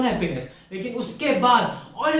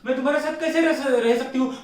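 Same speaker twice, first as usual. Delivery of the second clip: higher-pitched, gushing girlfriend imitation; mock gushing in a raised voice; mock awestruck girlfriend; 5 semitones higher than usual. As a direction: mimic her suddenly swooning over him. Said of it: "उस दिन उसको बोली".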